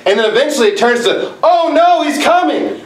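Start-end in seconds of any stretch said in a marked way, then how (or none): 1.4-2.8 high, urgent mock alarm of a wife in labor; panicked cry. Wife waking husband in labor, mock panicked cry. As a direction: retell her sudden panic, pitched up.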